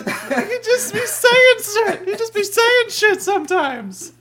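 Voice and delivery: laughing. Real, not acted. Laughing disbelief.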